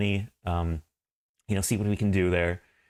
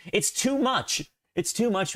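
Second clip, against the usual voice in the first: high-pitched complaint; overwhelmed; 11 semitones higher, slower than usual. Real, not acted. Streamer complains about too many emote tabs, overwhelmed. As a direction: whine it high, swamped by options.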